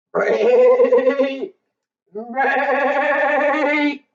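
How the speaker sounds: high braying call; a donkey-like bray sound; zebra bray imitation. Shown as a demonstration. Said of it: "braying in my ear".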